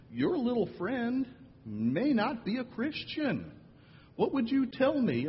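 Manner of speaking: put-on teacher coaxing a child, high and gentle; careful teacher voice. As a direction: tiptoe around it like a teacher.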